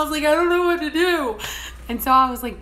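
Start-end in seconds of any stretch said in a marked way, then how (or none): 0.2-1.4 high, loud helpless protest; exasperated, raised-voice comeback; flustered arguing. Retold or imitated. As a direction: wail it back in a retold squabble. Retold quarrel over GPS.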